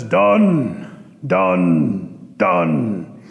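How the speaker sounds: low croaked "done"; bullfrog voice. Acted. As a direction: croak it like a frog. Bullfrog reports to Eagle.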